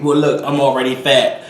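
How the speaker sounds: mock comeback in a put-on voice; mock retort. Vlogger answering imagined critical viewers, mock defensive retort. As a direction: answer back to the scolders, not yet shouting.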